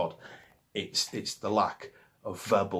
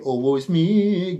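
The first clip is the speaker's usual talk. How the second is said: slow, higher, louder mocking whine; mock woe; 6 semitones higher, 10 dB louder, slower than usual. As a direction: ham up a self-pitying moan to mock grunge. Mocking whiny grunge bands.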